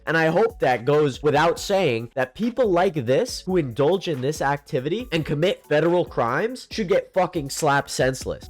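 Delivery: ordinary talk, nothing marked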